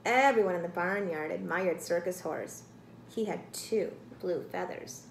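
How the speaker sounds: ordinary delivery, nothing marked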